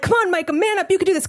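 high, urgent self-urging; self pep talk. Retold or imitated. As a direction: psych yourself up.

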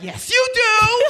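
high, drawn-out sing-out comeback; sassy retort. Retold or imitated. Talking back to the cab driver, sassy. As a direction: throw it back high and slow, full of sass.

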